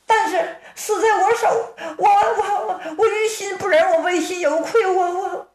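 put-on high, stammering, tearful young woman; stuttering through guilt and tears, pitched high; distraught girl. Sister arguing with Hua Yunping in high stammering tears.

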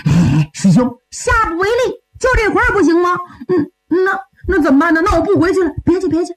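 high-pitched wheedling junior; 小师弟耍赖. Acted. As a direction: whine and bargain with your senior. (小师弟高声撒娇耍赖)

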